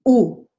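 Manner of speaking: long rounded Spanish ooh. Shown as a demonstration. Teacher demonstrating the Spanish vowel, a long rounded ooh. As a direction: hold "Ooh" with lips rounded and frozen. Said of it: "you want to feel it here".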